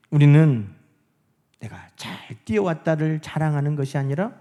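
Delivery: ordinary talk, nothing marked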